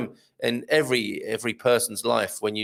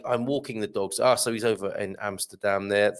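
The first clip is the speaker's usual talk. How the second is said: ordinary talk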